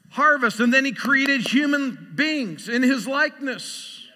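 loud, rising preacher's cadence; preaching at full force. Acted. Pastor preaching to the congregation with loud building fervour. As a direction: drive it home to the congregation.

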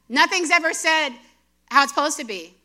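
ordinary talk, nothing marked

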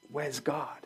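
soft, almost breathed question; hushed searching question. Retold or imitated. Elijah after the earthquake asking softly where God is. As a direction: ask it into the emptiness, barely voiced.

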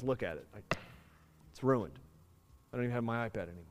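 put-on low, quiet teenage defeat; dejected frustration. Son showing dad his shattered iPad, quietly dejected. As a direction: own up softly, frustrated with yourself. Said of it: "In his anger and in his own frustration".

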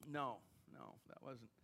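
muttered aside. Real, not acted. Joking aside after Brockton.

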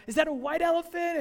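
thrilled, straining to see; bursting with excitement; excited question. Retold or imitated. Teammates excited on safari ride.

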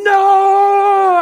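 long, high, loud drawn-out cry; baby's refusal. Acted. Unborn baby resisting being born, long loud refusal. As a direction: hold the word out at a high full shout. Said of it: "And that baby's saying".

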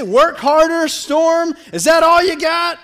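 loud, high, combative challenge; mock taunting shout. Preacher parodying a challenge to the storm, loud taunt. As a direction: yell it as a hammed-up dare.

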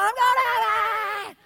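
yelled out. Retold or imitated. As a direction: bellow it happily, stretching each word. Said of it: "He just yelled out as he drove by".

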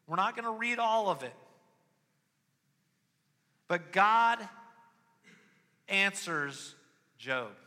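high, loud sermon climax; full-force preaching. Acted. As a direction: preach it out over the room.

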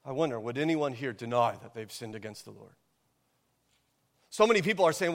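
ordinary talk, nothing marked

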